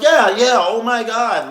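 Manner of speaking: high, loud, incredulous yeah; loud high exclaiming. Retold or imitated. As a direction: shout it up high in disbelief. His own retold reply.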